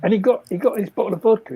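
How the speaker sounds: ordinary delivery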